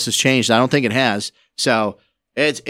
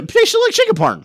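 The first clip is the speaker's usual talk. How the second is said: pompous sputtering colonel. Pretend. Mimics the Colonel's line.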